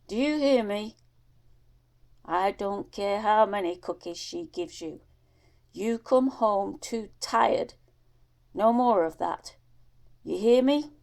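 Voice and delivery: a father's loud, scolding order; stern father. Acted. Father forbidding his son the old lady's house, loud scolding. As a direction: lay down the law, loud and firm.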